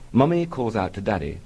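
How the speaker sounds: ordinary talk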